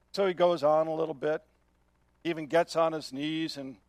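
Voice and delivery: ordinary talk, nothing marked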